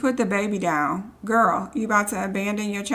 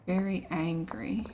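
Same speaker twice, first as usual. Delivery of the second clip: breathy hushed whisper; whispered aside; 3 semitones lower, 7 dB quieter than usual. Real, not acted. Whispering to viewers about the angry woman, hushed. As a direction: whisper it like a secret.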